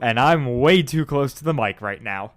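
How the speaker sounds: loud announcer voice, close on the mic. Acted. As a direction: keep the booming host voice going. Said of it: "I'm using my announcer voice".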